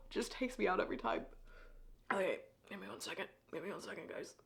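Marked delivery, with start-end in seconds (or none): 2.1-4.3 much softer, rushed words through tears; quietly crying. Real, not acted. Reader asking viewers for a moment, quietly crying. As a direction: murmur it while trying to stop crying. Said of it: "I'm gonna rally".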